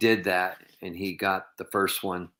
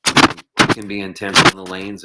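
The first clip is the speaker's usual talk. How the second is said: ordinary talk, nothing marked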